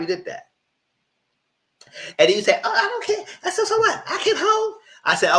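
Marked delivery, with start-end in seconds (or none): 2.6-4.7 high, laughing mock of a careless girl; squeaky giggly mimic, brushing it off; mock Madison. Vlogger mocking Madison coming home late, in a high giggly voice.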